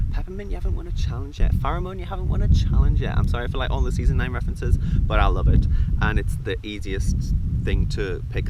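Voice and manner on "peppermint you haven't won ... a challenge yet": loud, higher mock host verdict; imitating Rue. Judge announcing to the queens, loud and high. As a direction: boom it out like Rue judging. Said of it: "She's like".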